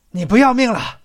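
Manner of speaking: put-on stern old man's sharp warning; stern scolding. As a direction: scold him like a worried old master. Old craftsman scolds him at dawn.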